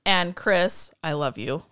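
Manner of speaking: ordinary delivery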